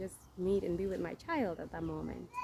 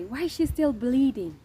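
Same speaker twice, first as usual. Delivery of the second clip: retold nurse's alarm, higher and louder; alarmed question; 5 semitones higher, 7 dB louder than usual. Nurse asks why she is still bleeding, alarmed. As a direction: act out a nurse's startled question.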